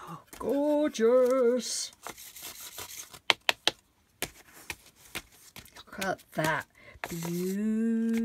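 loud, slow, drawn-out gushing; gushing admiration. Real, not acted. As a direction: stretch out the vowels, marvelling out loud. Gushing over paint after whispering.

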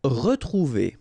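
"Retrouver" said ruh-troo-VAY; plain French "Retrouver" with a short uh; French word. Shown as a demonstration. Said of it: "really pronounced like e".

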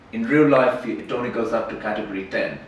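ordinary talk

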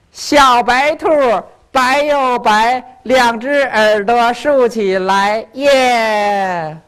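slow, sing-song nursery rhyme; child reciting. Acted. Child performing a rhyme for uncle, slow sing-song. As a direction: chant it slowly like a kindergartner. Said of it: "学了一首儿歌".

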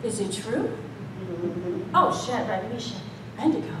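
milk the drama, lightly; dramatic queen. Retold or imitated. Preacher as a queen questioning the boys, mild drama. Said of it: "I might have had that drama".

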